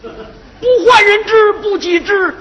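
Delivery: high, sing-song classical recitation that falters at the end; quoting the ancients. Acted. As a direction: recite pompously, then stumble over the words. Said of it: "古人云".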